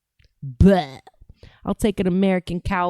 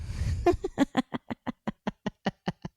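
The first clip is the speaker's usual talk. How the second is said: breathy laugh; 4 semitones higher, 8 dB quieter than usual. Real, not acted. Laughing at own mockery.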